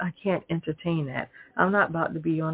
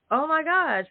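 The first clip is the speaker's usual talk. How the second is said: exasperated high oh my gosh; 10 semitones higher than usual. Real, not acted. Exasperated exclamation.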